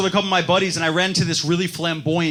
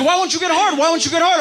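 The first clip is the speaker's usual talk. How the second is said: frustrated; 11 semitones higher, faster than usual. Retold or imitated. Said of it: "She's like".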